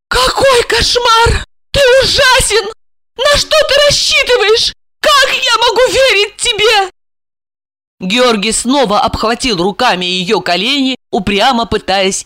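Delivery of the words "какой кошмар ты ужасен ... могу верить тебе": very high-pitched, appalled woman; frightened. Acted. Wife realises her husband is dangerous, frightened. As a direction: cry out in shock and fear. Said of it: "Ольга испугалась".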